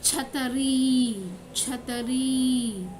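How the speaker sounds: "छतरी" slowly, final ī held long; pronunciation demo. Shown as a demonstration. Teacher modelling for children, slow "छतरी" with drawn-out ending. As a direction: spell out "छतरी" by sound, stretching the last ī. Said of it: "इसका pronunciation किस तरह करना है".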